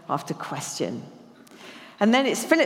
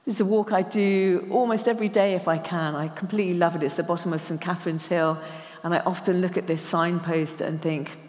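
ordinary talk, nothing marked

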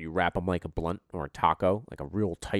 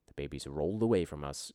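ordinary delivery

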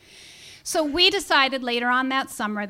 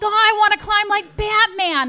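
put-on much higher, louder eager child voice; excited kid; 9 semitones higher, 6 dB louder than usual. Son begging to climb the cliff, excited kid. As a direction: bounce with a kid's excitement. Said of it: "He's like".

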